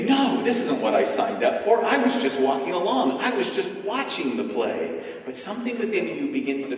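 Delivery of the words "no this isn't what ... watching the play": higher, anxious refusal; reluctant protest. Retold or imitated. Visitor inwardly refusing the improv role, anxious. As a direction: plead your way out of it. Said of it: "wants to immediately say".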